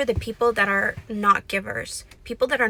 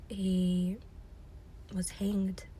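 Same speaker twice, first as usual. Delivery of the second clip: dramatic whisper; 7 dB quieter, much slower than usual. Acted. Dramatic end of Haman.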